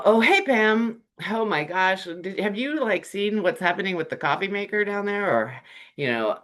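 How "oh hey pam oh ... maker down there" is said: high, lively chatty opener; upbeat gossipy greeting. Shown as a demonstration. Coworker greeting Pam with chatty coffee maker gossip, high and upbeat. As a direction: pull her into fun office gossip. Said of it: "in the tone and energy you want".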